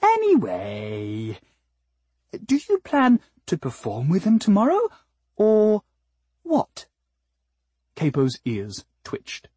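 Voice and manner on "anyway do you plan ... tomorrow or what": sweet flower singer. Acted. Flower probing the cat's plans, sweet and high. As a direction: stretch the first word, then climb sweetly higher.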